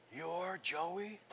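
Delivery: very soft, wondering question; hushed amazement. Retold or imitated. Old neighbor recognizing the boy, amazed. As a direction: ask it softly, in wide-eyed disbelief. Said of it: "his eyes got so big".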